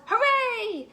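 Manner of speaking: loud, high cheer; cheering crowd. A narrator's reading. The zoo crowd cheers Milo's dance, loud cheer. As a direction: yell it like a happy crowd. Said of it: "The crowd yelled".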